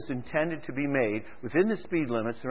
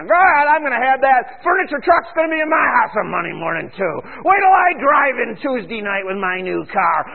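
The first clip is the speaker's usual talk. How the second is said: louder, higher, gloating shout; mock boastful shopper; 7 semitones higher, 6 dB louder than usual. Preacher mocks a boastful buyer with a loud gloating shout. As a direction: crow over the new furniture and car. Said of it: "we run her through there".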